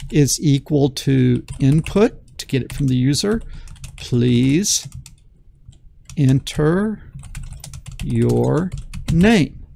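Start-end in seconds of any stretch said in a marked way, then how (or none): 4.0-9.7 each word spoken apart with long pauses; dictated slowly. Shown as a demonstration. Teacher dictating the prompt, slow word by word. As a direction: spell it out word by word for the students. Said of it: "like that, okay?".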